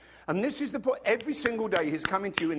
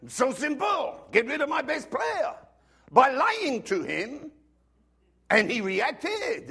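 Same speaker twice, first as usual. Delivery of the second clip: high, loud mock coach gushing; French coach quote; 9 semitones higher, 4 dB louder than usual. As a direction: ham up the delighted coach. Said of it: "said this".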